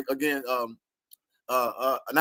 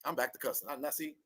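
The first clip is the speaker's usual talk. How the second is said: quiet aside; 3 semitones lower, 13 dB quieter than usual. Real, not acted. Scolding herself for cussing, quietly. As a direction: drop much softer, half to herself.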